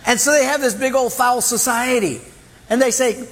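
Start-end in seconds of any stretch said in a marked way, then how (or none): none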